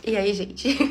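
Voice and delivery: usual speaking voice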